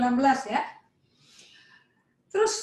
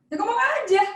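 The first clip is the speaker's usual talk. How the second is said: dismissive, high, pushed out; curt reply; 9 semitones higher, 4 dB louder than usual. Acted. Saul brushing off Samuel, curt and dismissive. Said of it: "sekarang lihat nih nadanya nih".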